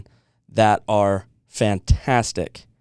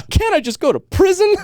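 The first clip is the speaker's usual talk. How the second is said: squeaky, much higher desperate plea; a hammed-up fan begging the judge; pleading fan. Fan in court begging for prison over no Doctor Pepper, high squeaky plea.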